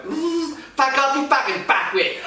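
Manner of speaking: high, laughing mock old-man snarl; grumpy old man. Eighty-year-old driver swearing back, laughing mock old-man voice. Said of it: "he went".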